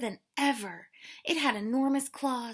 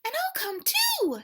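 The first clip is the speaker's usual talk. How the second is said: squeaky high little mouse; 6 dB louder than usual. Acted. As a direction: chirp it up like a tiny mouse.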